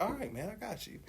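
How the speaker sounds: very soft, high little reply; retold sheepish surrender, hushed and high; meek giving in. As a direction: back down almost under the breath. Acted giving in to sponsor.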